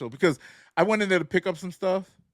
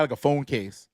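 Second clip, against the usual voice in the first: ordinary talk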